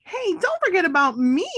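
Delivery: high, loud call for attention; shouting. Acted. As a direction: yell so they don't forget you. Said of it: "shouted Amy".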